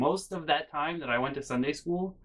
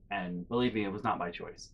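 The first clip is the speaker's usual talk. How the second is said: usual speaking voice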